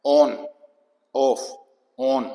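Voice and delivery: on, off, on with a short open O; short O sound. Shown as a demonstration. Teacher contrasting short with long O, short O. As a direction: keep "on, off, on" brief and open. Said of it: "देखो कितना फर्क है".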